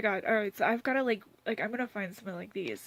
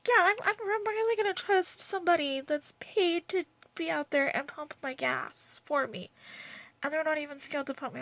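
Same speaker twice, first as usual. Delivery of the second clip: sarcastic; 6 semitones higher than usual. Real, not acted. Mocking the commenter.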